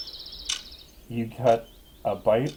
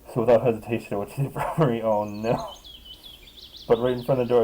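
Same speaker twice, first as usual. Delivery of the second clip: ordinary delivery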